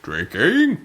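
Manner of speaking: another strained grand voiceover try; Matt Berry impression. Shown as a demonstration. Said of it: "Try it at a lower pitch".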